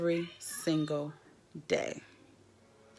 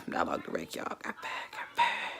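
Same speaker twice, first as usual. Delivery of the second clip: soft whisper, half to self; 10 dB quieter than usual. Real, not acted. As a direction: breathe the words out like a secret. Repeating firing confession.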